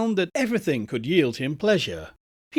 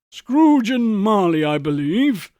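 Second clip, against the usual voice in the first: put-on slow, louder, higher greeting of a stately old gentleman; portly old gentleman; 4 semitones higher, 6 dB louder than usual.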